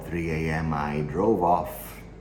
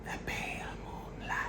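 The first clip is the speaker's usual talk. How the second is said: breathy, very soft whisper; hushed whisper; 12 dB quieter than usual. Acted. Killer recalling a knife in the park, whispered. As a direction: let it drop into a secretive whisper. Killer savours the dark scene.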